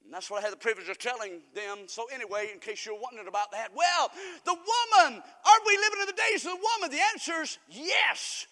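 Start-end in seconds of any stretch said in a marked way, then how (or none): 3.7-8.5 high, loud rhetorical question and answer; emphatic pulpit cry, stretched at the ends; full-force preaching. Real, not acted. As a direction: call it out to the congregation.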